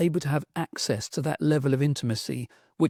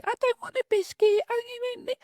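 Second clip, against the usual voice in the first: put-on much higher, kid-like whine; whiny child. Nine-year-old boy refusing his mum's biscuit, high childish whine. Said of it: "nine-year-old Robin would say".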